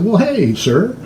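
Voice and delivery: lightly hammed-up posh purchase, pitched high; a hint of stiff formality, then delight; mock formal. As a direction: play the overly polite tourist. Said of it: "And I said".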